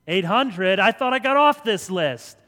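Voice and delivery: loud, irritated hang-up on a telemarketer; fed-up shout at a sales call; annoyed brush-off. Pretend. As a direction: snap them off the line.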